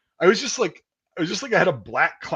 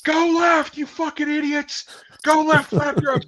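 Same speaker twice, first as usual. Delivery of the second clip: high, loud barking commands; drill sergeant yell; 14 semitones higher, 4 dB louder, slower than usual. Retold or imitated. Drill sergeant yelling at recruits at the door, loud bark. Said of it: "drill sergeant ... going".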